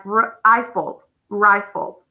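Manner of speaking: "Rifle" sounded out as r, eye-ful, then blended; long I. Shown as a demonstration. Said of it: "That's a long I".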